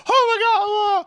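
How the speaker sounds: high, loud hammed-up panic over a fallen baby; a parent losing it in mock alarm; mock panicked parent. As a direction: overplay the frantic parent, shrieking. Mocking panicking parent picking up.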